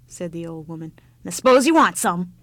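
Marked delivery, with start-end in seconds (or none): 1.2-2.3 grudging. Acted. Grudging old woman.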